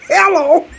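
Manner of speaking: very high, loud shrieked hello; excited shriek of hello; screamed hello. Acted. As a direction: scream the greeting with delight. Screamed greeting.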